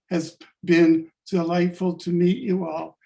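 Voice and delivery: ordinary talk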